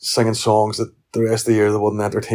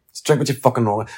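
ordinary talk, nothing marked

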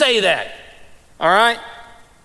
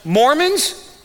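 normal talking voice

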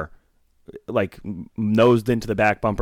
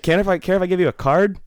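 high, hesitant ask; hammed-up high polite ask; mocking timid recruiter; 10 semitones higher, 4 dB louder than usual. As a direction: pitch it up and ask shyly. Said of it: "He's like".

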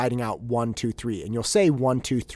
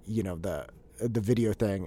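ordinary talk

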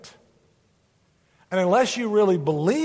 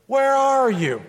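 loud searching call, higher; 6 semitones higher, 4 dB louder, slower than usual. Shown as a demonstration. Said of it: "Say that with me".

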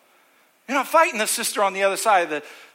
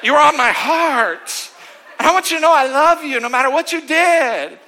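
gushing church lady; 6 semitones higher, 5 dB louder than usual. Retold or imitated.

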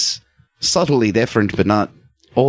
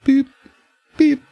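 ordinary talk

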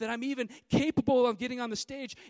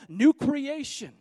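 loud, driving preacher's proclamation; thundering pulpit declaration; full-force preaching; 3 semitones higher, 10 dB louder than usual. Acted. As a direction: hammer it home to the congregation. Preaching climax.

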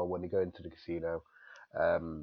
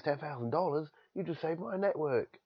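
high-pitched impression of a delighted caller; imitated amazed gratitude, pitched way up; Rupert Murdoch; 10 semitones higher than usual. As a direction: exclaim it in a raised, put-on voice. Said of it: "Rupert Murdoch".

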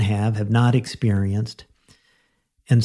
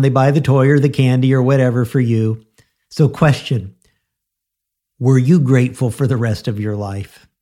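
usual speaking voice

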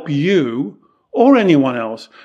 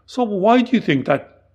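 higher-pitched, mildly puzzled question; puzzled master; 6 semitones higher than usual. Retold or imitated. Master questioning his student's remark, mildly puzzled. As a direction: lightly imitate a puzzled master asking why. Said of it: "he looked puzzled and he'd put his head to one side".

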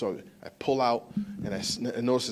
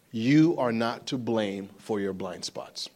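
slow and deliberate; 4 semitones lower than usual. Shown as a demonstration. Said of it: "Take this in".

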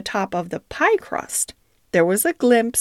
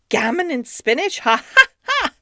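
triumphant shout; 8 semitones higher than usual. Acted. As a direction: crow it out in triumph. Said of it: "shouted Doctor Maggotty triumphantly".

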